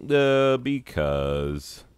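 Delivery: dumb shrugging answer. Retold or imitated. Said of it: "And they're like".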